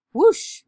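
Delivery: loud, sudden whoosh; whoosh noise. A narrator's reading. Narrator voicing the hair flying off, loud whoosh. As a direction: imitate hair being blown away. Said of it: "went Doctor Dinglebat's hair".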